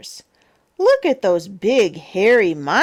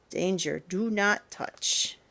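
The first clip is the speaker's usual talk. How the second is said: hushed warning; 9 dB quieter than usual. Acted. Reading a warning sign.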